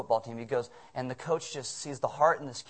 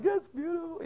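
a much higher, choked-up "That's beautiful."; moved coaches. Retold or imitated. Coaches watching Jake score, high moved exclamation. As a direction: say it as a coach overcome by the moment. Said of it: "coaches are like".

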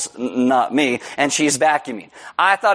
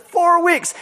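shocked repeat; 13 semitones higher than usual. Retold or imitated. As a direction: echo it back stunned.

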